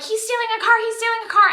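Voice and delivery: high, urgent repeated report; retold panic. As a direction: imitate a panicked call to the police. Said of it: "And I'm, like, panicking".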